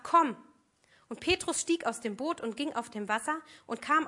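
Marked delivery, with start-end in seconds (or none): 0.0-0.4 louder single word of summons; firm call. Acted. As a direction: call him over firmly. Jesus answers Peter. (Jesus ruft Petrus laut herbei)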